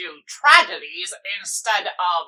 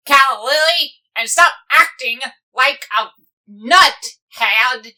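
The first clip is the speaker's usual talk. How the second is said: high, loud pirate rant that keeps building; angry pirate scolding; 5 semitones higher, 7 dB louder than usual. Acted. Pirate captain scolding Calla Lily for her meddling, angry yell. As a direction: bellow it at her, each insult louder.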